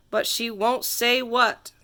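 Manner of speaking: plain reading voice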